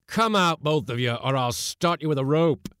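high, loud angry order; irritated roar. A narrator's reading. Skipper ordering seamen out of the galley, roaring. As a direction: bellow the threat at the crew. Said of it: "roared the irritated commander".

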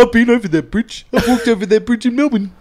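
put-on high, loud Kiwi accent. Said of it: "That is not a Melbourne accent".

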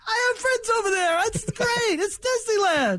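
high, giddy fanboy voice; mocking Hackett. Host mocking Hackett's praise of rivals, high and giddy. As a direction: gush like it's Disneyland. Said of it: "That's better than".

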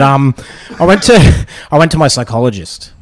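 ordinary talk

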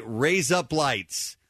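drawn out, each word apart; slow and deliberate. Shown as a demonstration. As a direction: say raise up lights slowly, word by word. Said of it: "If you say".

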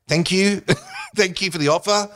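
high, over-polite thanks; awkward polite refusal. Retold or imitated. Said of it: "I don't wanna hurt her feelings".

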